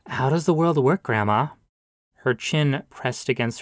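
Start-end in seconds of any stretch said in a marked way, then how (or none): none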